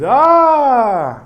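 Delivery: loud high eager "Ah!"; a hungry crowd cheering the tray's return; excited gasp. Retold or imitated. Crowd sees the tray return.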